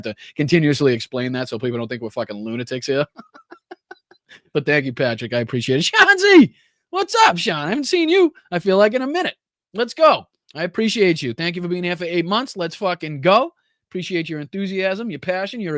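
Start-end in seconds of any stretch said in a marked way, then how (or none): 5.9-10.3 high, loud, thrilled shout-out; excited greeting. Real, not acted. Host spots a returning viewer in chat, excited hail. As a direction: holler a hello to a long-lost regular.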